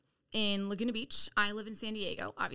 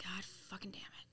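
hushed retold curse under breath; whisper; 2 semitones lower, 14 dB quieter than usual. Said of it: "And I'm like".

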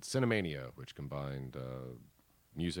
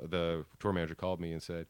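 ordinary delivery

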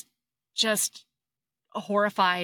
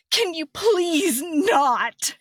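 higher, slow drawn-out please; whiny plea; 6 semitones higher, 4 dB louder, much slower than usual. Retold or imitated. Hero asking his wife to stop sighing, slow whiny plea. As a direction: stretch the plea out in exasperation.

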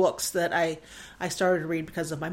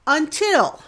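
choked up for real on this one word; genuinely moved, pitch jumping up; emotional; 7 semitones higher, 6 dB louder than usual. Real, not acted. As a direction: voice catching as emotion rises. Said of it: "oh, I get emotional".

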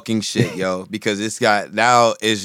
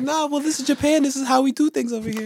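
high-pitched, amused put-on voice; laughing mock reply; 14 semitones higher than usual. Japanese host correcting the American guest, laughing high voice. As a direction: say it through laughter.

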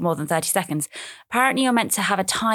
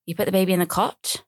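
ordinary talk, nothing marked

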